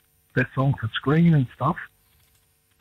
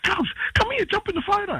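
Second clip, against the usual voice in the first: high, excited call across the room. Retold or imitated. Musician calling a singer into the photo, high excited call. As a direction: call her over, pitched way up.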